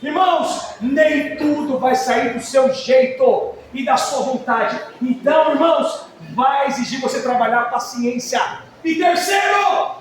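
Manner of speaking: loud, slow emphatic preaching; forceful preaching. Real, not acted. Pastor hammering points to his congregation, loud and emphatic. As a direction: drive each point home.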